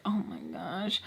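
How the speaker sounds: very soft, breath-only whisper; scared whisper. Retold or imitated. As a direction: whisper it in dread. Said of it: "I'm just, like, scared".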